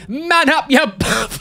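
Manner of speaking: high-pitched mock goading; mock scolding. Imitating the woman telling the tattooed man off, mock scolding. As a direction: egg him on, hammed-up. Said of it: "She's like, um".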